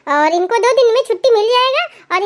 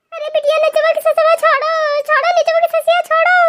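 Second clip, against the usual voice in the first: lightly voiced older village woman, higher and soothing; village mother-in-law; 6 semitones higher than usual. Mother-in-law tells the crying bride to chant Ram, soothing. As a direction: coax the crying bride to pray.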